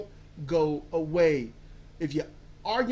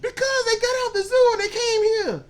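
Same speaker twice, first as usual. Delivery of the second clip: excited laughing protest; 14 semitones higher, 7 dB louder than usual. Real, not acted. Defending absurd lion scenario.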